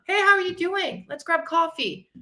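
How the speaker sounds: a friendly mock invite to coffee; cheery greeting. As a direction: sound delighted to reconnect. Acting out a text to a friend.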